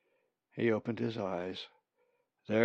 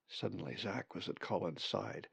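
usual speaking voice

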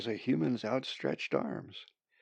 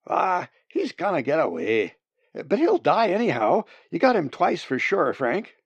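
loud, high, animated voice; hunters calling out; 6 semitones higher, 7 dB louder than usual. Acted. Hunters see the wounded bird escaping, calling out. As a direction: call to your partner over the wind.

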